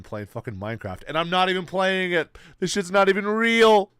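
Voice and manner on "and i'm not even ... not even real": higher and louder, building to a yell; mock-outraged outburst; loud excited rant. Real, not acted. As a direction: rant it out to chat, getting louder. Ranting to chat, building up.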